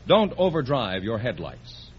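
usual speaking voice

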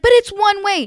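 high, loud mock objection; protesting driver. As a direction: imitate a flustered driver pushing back.

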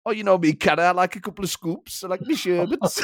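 German pundit impression, tipping into laughter; amused put-on pundit voice; Didi Hamann. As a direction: imitate his cheeky confession in a German accent. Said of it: "It's so funny the way he talks".

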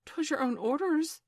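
high-pitched, defensive protest; faltering. A narrator's reading. Boatswain excusing himself to Hook, faltering. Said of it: "the boatswain faltered".

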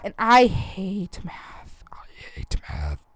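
hushed, breathy whisper; whispered confession. Real, not acted. Mom confessing to listeners, hushed whisper. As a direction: whisper it like a secret. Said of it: "whispered confession about math".

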